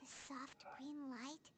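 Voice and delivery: soft slow whisper. Acted. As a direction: breathe it out in a whisper. Paimon whispering a thought.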